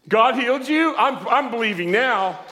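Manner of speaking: high, loud amazed yell; astonished shout. Retold or imitated. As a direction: blurt it out loudly in acted amazement. Acts out his astonished reaction.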